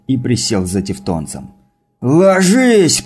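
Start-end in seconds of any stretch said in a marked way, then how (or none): 2.0-3.0 sudden loud high warning shout. A narrator's reading. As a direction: yell it out to take cover. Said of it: "крикнул Ильмар".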